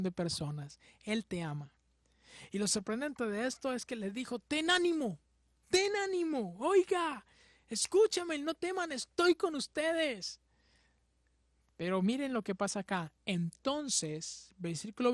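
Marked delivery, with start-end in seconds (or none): 4.4-10.4 high, pressing call of comfort; urgent call. Acted. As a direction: call them to courage, raised high. Jesus calling to frightened disciples.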